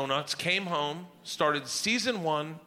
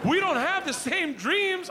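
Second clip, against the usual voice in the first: exasperated wife; 12 semitones higher than usual. Retold or imitated. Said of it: "My wife goes".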